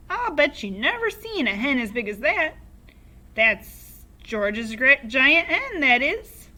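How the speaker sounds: put-on high, loud old grandma voice; Grandma shouting. A narrator's reading. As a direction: crow it down from the rooftop like a cheeky old granny. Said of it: "Grandma shouted".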